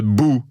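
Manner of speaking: short, abrupt "bou"; a quick boo to make someone jump; startling boo. Shown as a demonstration. Showing what you say to startle someone, short boo. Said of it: "quand tu veux faire le saut à quelqu'un".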